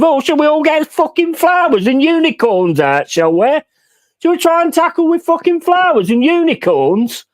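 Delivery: high, sneering mock question; sarcastic. Real, not acted. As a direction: ridicule the idea of gentle tackling. Sarcastic jab at soft football.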